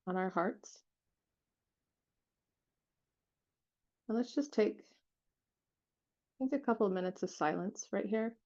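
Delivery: unhurried meditation guide, long silences between phrases; guided meditation. Real, not acted. Leading listeners to relax and feel their hearts, guided meditation voice. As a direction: guide them gently, leaving space after each phrase.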